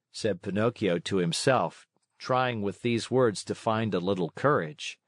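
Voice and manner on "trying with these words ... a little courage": plain reading voice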